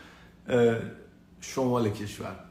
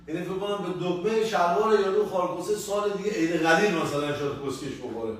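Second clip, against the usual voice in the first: ordinary delivery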